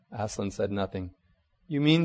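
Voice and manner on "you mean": usual speaking voice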